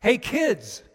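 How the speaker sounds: loud, high call across the water. Retold or imitated. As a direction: call affectionately to the boys on the boat. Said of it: "a voice calls out".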